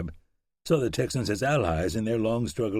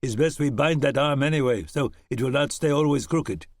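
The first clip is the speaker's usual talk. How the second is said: lightly voiced German accent, higher; a hint of a German settler; 5 semitones higher, 4 dB louder than usual. German helper to Webb, light German accent. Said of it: "with an upturned mustache and a German accent said".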